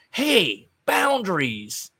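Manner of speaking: high, loud, dragged-out "boundaries!"; mock indignant yelp. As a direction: play a scandalized nerd setting limits. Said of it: "Adam Cole grabs his wrist and goes".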